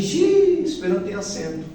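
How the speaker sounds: imagined student's dismay; dismayed reaction. Pretend. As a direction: act a put-off beginner spotting a problem. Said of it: "vocês vão dizer assim".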